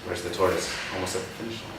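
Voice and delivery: plain reading voice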